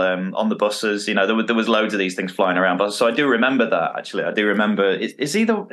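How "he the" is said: high, fussy Frank Spencer voice; a lightly voiced Frank Spencer, pitched up; Frank Spencer impression. Comedy fan imitates Frank Spencer for friends, pitched high. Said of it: "That was, that was uncanny".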